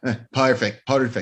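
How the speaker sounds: hammed-up Scottish accent on "perfect"; bad Scottish impression; [name]'s Scottish accent. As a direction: stretch "perfect" into a mock Scottish "pie-erfect". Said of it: "the accent".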